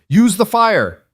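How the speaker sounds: high, loud battle shout. A narrator's reading. Rowan calling to the settlers, high loud shout. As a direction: yell it across the fight. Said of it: "Rowan shouted".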